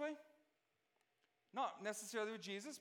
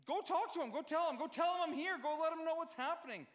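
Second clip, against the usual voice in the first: raised, insistent orders; offended demand; 6 semitones higher than usual. Retold or imitated. John the Baptist sending his disciples, indignant and raised. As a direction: demand it like a slighted prophet. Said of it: "This is John's attitude here".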